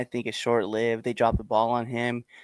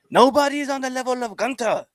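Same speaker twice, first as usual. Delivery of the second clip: high-pitched mock Austrian accent; pitched-up hammy Austrian brag; Gunther impression; 12 semitones higher than usual. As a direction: ham up a German-speaking wrestler's accent. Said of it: "I don't know if that's a perfect accent or not".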